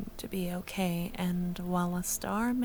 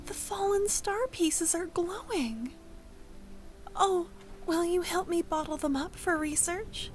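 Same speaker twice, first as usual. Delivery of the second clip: higher, bright with delight; excited wonder; 8 semitones higher than usual. Real, not acted. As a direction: gush over the glowing pieces. Thrilled by glowing star pieces.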